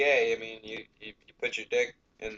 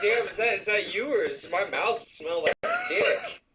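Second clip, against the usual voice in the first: laughing through words; 8 semitones higher, 3 dB louder than usual. Retold or imitated. Voicing victim, laughing.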